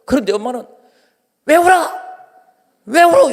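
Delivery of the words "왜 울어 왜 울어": irritated mother. Retold or imitated. Mother scolding her crying toddler out of the car, irritated. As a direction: snap at the child like an annoyed mom. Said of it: "이렇게".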